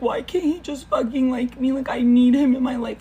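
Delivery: desperate whine. Retold or imitated. Pining quote while scrolling TikTok.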